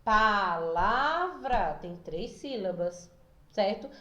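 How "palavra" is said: PALAVRA drawn out as pa-la-vra; split into syllables. Shown as a demonstration. Teacher showing pupils what a syllable is, syllable by syllable. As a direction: say "Palavra" slowly, one stretched syllable at a time. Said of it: "É quando eu separo a palavra".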